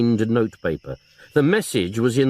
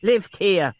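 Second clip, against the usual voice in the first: put-on higher old woman's voice; old aunt; 8 semitones higher than usual. Elderly aunt explaining herself to detective, flustered.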